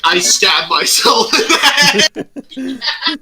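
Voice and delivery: high-pitched quote dissolving into laughter; breaking into laughter. Retold or imitated. Game master retelling a player's absurd move, cracking up laughing.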